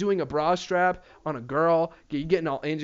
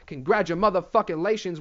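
ordinary talk

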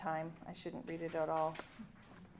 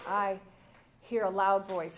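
"hear" and "loud" said loud and falling; present tense stressed; 8 dB louder than usual. Shown as a demonstration. Preacher marking the present tense for listeners, stressed. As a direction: hammer home I hear a loud voice. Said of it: "present tense".

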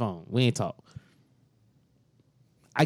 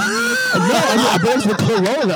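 laughing protest; 6 semitones higher, 5 dB louder, slower than usual. Real, not acted. Defending car joke while laughing.

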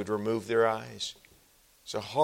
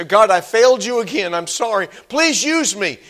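higher, louder repentant plea; pleading prayer; 9 semitones higher, 9 dB louder than usual. Retold or imitated. Sinner confessing to God, pleading. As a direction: beg God earnestly. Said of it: "you say".

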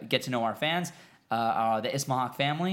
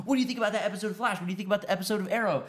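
imitating eager fans, high and rapid; quick, higher-pitched mock fan voice; pestering fans; 6 semitones higher, much faster than usual. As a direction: rattle off questions like insistent viewers. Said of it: "you guys are always asking us".

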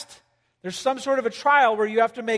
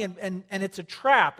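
usual speaking voice

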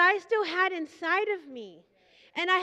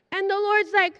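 ordinary talk

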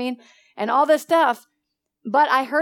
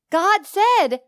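raised, higher call; loud call; 7 semitones higher, 5 dB louder than usual. Real, not acted. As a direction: ring it out to the room. Preacher calls out.

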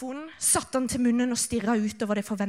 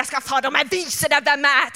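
defiant thought; 5 dB louder than usual. Retold or imitated. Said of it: "tenkte jeg".

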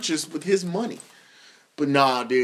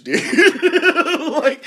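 ordinary delivery

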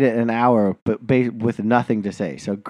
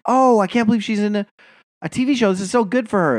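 delighted; 9 semitones higher than usual. Retold or imitated. Retelling own delighted reaction.

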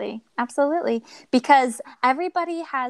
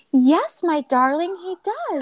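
higher, louder sweet motherly voice; a sweet lady pitched up; kind receptionist; 8 semitones higher, 5 dB louder, slower than usual. Retold or imitated. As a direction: play the doting receptionist.

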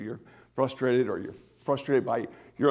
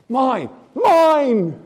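"Mine, mine" loud and high, falling away; emphatic claim; 11 semitones higher, 10 dB louder than usual. Acted. Pastor voicing God claiming his peace, loud emphatic cry. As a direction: proclaim it as God's own.

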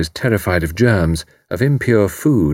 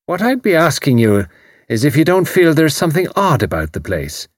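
Irish landlady; 7 semitones higher than usual. A narrator's reading. Mrs O'Rourke voiced.

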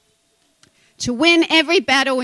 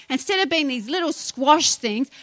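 ordinary delivery

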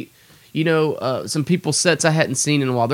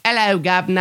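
cheeky, higher-pitched mock British accent; hammy British greeting, pitched up; little British accent; 7 semitones higher, faster than usual. Shown as a demonstration. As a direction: put on a bad British accent. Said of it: "doing my little British accent".

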